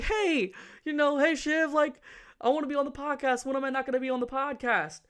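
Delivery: high-pitched mock begging; begging friend. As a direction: imitate a friend pestering for an invite, high and insistent. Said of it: "He's been begging".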